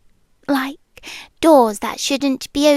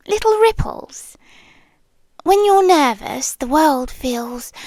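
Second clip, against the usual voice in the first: ordinary delivery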